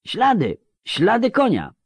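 very high-pitched called-out announcement; shouting over wind. A narrator's reading. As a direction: call the news across a gale. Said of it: "przekrzykując wichurę". (wołanie wysokim głosem przez wichurę)

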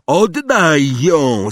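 loud, high, drawn-out demand; Harry calling out. A narrator's reading. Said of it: "zawołał Harry".

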